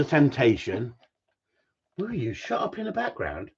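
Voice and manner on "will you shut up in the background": irritated aside. Real, not acted. Telling off someone in background.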